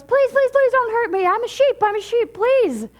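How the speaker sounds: high, loud frightened begging; hammed-up high-pitched panic; panicked pleading. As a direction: plead for your life as a terrified little cub. Little lion begs for its life.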